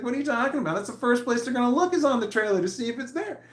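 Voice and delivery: high disbelieving mock protest; incredulous retort.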